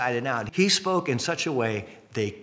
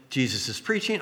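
usual speaking voice

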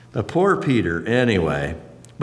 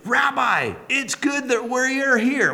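eager Peter; 12 semitones higher, slower than usual. Acted. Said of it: "he goes".

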